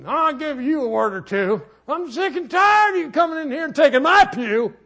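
high, loud hammed-up angry shout; mock church grouch. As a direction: play a petty churchgoer ranting over a pew. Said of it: "You walking up".

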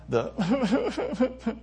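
breaking into laughter mid-sermon; giggling high while trying to go on; laughing. Real, not acted.

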